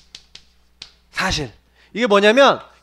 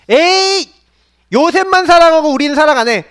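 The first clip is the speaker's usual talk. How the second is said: jealous grumble; 4 semitones higher, 3 dB louder than usual. Acted. Brothers grumble.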